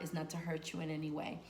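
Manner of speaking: usual speaking voice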